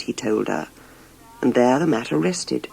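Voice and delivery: plain reading voice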